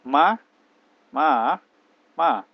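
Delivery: "Ma, ma, ma" said in Mandarin tones, each one a different tone; Mandarin tones demo. Shown as a demonstration. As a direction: give each "ma" its own Mandarin tone, first one loud. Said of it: "Bahasa Mandarin itu apa?".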